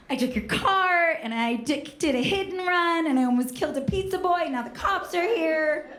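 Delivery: high-pitched mock panic; hammed-up teenage confession; frantic confession.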